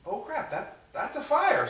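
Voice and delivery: high-pitched mock panic; alarmed realization. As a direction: jump up high as it dawns on you. Realizes microwave is on fire.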